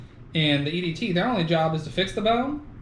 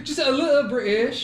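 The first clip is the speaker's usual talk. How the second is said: higher, laughing mock British; Cockney-style British; 7 semitones higher, 3 dB louder than usual. Shown as a demonstration. Defending the attempt to a friend, in laughing mock Cockney. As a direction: play up a bad Cockney accent. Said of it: "why you Cockney?".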